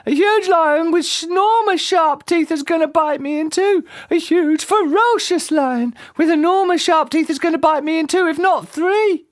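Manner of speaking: very high panicked shrieking; shrieked. A narrator's reading. Mister Jelly convinced a lion is there, shrieking in panic. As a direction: work up a hysterical high-pitched fright. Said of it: "shrieked Mister Jelly".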